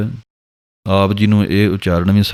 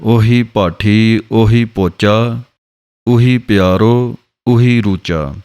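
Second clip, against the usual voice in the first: slow measured recital; scripture recitation; slower than usual. Shown as a demonstration. Teacher to students, reciting the scripture line slowly. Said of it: "ਪੰਕਤੀ ਹੈ".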